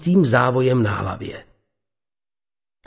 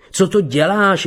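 mild narrated outcry, pitched up; crying out; 7 semitones higher than usual. A narrator's reading. Bernard protests to Beata hitting him, a mild outcry. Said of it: "vykřikl".

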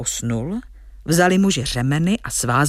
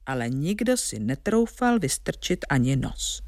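usual speaking voice

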